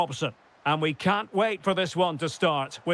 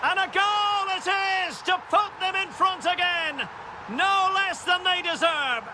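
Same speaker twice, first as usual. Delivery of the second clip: excited goal call; 12 semitones higher than usual. Acted. Commentator as the team goes back in front, excited. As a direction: cry out the goal at the top of the voice.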